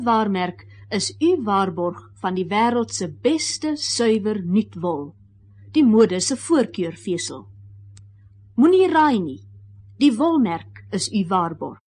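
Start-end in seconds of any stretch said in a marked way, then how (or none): none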